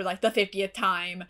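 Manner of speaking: usual speaking voice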